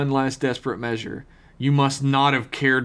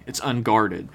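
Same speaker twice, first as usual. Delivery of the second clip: normal talking voice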